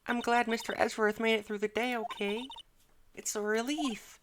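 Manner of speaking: voiced high like a teen girl; Maya voice. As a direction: play the cheerful young assistant. Voicing Maya in game playthrough.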